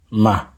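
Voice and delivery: Ma kept on a low level pitch. Shown as a demonstration. Teacher models a low tone for learners: Ma low. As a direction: drop Ma low, no whisper.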